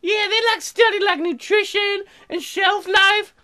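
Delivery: put-on high, airy-headed colonist girl; Sarah voice. Colonist Sarah chatting with Fardarella, high ditzy voice. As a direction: play her as a ditzy, cheerful newcomer.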